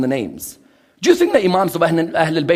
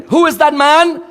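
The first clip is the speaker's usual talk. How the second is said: ordinary talk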